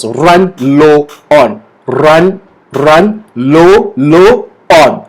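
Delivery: slow, broken into single words; slowly. Shown as a demonstration. Teacher helps those struggling to say it, slowly. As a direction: drill it word by word, drawn out. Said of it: "say it slowly first".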